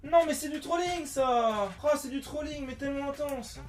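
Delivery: high, loud exasperated outburst; frustrated yelling. Real, not acted. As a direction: rant at the chair in frustration. Forgot a part, outburst.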